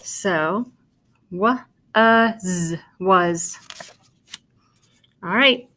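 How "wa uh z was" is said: "wa-, uh, z" in pieces, then blended "was"; sounding out. Shown as a demonstration. Teacher showing how was sounds, sounding out and blending. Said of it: "A is gonna sound like a short U".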